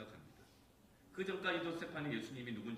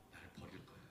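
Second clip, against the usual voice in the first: normal talking voice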